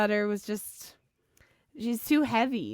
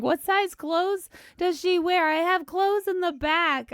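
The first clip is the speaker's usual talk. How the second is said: lightly imitated passerby, pitched higher; 9 semitones higher, 3 dB louder than usual. Woman driving by offers clothes to a struggling parent, higher imitated voice. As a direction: raise the pitch throughout as a stranger calling over an offer. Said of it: "a woman was like".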